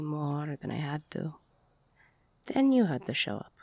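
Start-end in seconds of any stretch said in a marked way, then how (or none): 2.5-3.5 louder, fast resentful blame; a quick loud reproach. Acted. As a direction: throw the blame at them.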